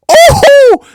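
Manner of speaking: very loud, high "Oh!"; explosive crowd-style reaction; awed whoop. Acted. Fan reacting to a great move, loud high whoop. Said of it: "you're kinda like".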